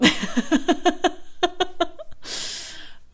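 high, loud burst of laughter; laughing. Real, not acted. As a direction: crack up at your own accent. Laughs at own accent slip.

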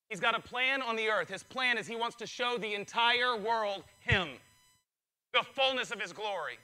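usual speaking voice

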